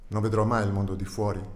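usual speaking voice

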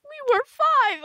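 shrieking outraged yell; outraged shout. Acted. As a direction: scream it in outrage.

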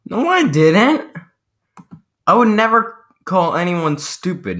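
playfully outraged squeak of denial; mock indignant denial. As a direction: deny it in a high, offended voice.

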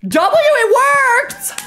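high, drawn-out cry of triumph; excited shriek. Real, not acted. Streamer shrieking in triumph as the pet obeys. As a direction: stretch it out in a delighted squeal. Game trick succeeds, elated cry.

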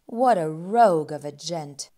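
ordinary delivery, nothing marked

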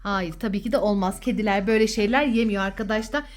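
ordinary talk

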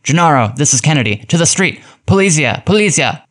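high-pitched urgent call, rising as it goes; shouted warning. A narrator's reading. Kennedy warning Gennaro through the dictograph, shouting urgently. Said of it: "pulled down the other switch and shouted".

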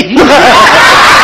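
loud burst of laughter; big laugh. Real, not acted. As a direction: crack up. Laugh after punchline.

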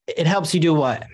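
normal talking voice

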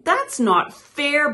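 high, loud protest; bawling. A narrator's reading. Toad protesting Frog's insult, high loud bawl. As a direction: bawl it out in hurt indignation. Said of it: "bawled Toad".

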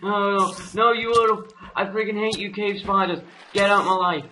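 high-pitched, exasperated outburst; agitated anger. Real, not acted. Gamer swarmed by cave spiders, agitated and angry. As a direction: snap at attackers in alarm.

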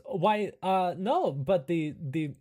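lightly voiced quirky camp host; helpful but hesitant character voice; Igor. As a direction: offer the odd suggestion in character. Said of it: "And Igor says".

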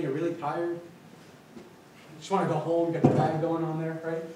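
usual speaking voice

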